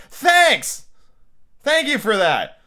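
high, loud mock thanks; sarcastic outburst. Reader thanking the author for the reminder, loudly sarcastic. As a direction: burst out in exaggerated gratitude.

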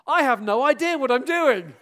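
high, loud laughing confession; laughing self-mockery. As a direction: laugh through the admission. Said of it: "repeatedly say from the front".

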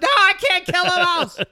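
high, loud mock-hysterical refusal; shrieking falsetto of a panicked caller; imitated panicked screaming. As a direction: wail it in exaggerated terror. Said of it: "Blood-curdling screaming on the phone".

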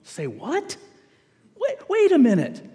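mock disbelief at what you just read; baffled double take. Preacher voicing a puzzled reader of Ecclesiastes, baffled double take. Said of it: "and you don't go".

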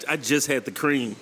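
ordinary talk

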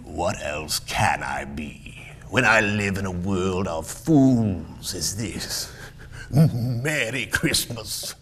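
put-on slow, sour old miser; grumpy Scrooge. Miser scoffs at a world of fools, disgusted. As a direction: grumble it slowly with scorn.

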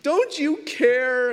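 put-on slow, high, drawn-out kid whine; whiny child. Father imitating his daughters, slow high whine. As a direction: stretch it out like a sulking little girl. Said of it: "they ask me this question".

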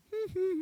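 high-pitched mischievous giggle; gleeful giggle. Retold or imitated. Back to work after dumping shake on car, gleeful giggle. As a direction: giggle high to yourself, pleased with the revenge.

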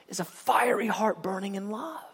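breathy hushed whisper, drawing listeners close. Real, not acted. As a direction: whisper it like a secret of the heart. Preacher drops to intimate climax.

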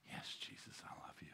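breathless whispered confession. Acted. Believer whispering love back to Jesus. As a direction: barely breathe the words out.

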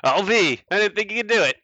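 put-on high, loud amazed old sailor; surprised sailor. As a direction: gasp it out, impressed despite yourself. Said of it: "He says".

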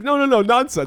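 high-pitched, brushing-it-off protest; mock dismissal. Janice to man dangling from ladder, breezily dismissive imitation.

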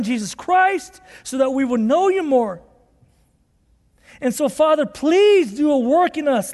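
prayer. Acted. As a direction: pray over the people, lifting your voice.

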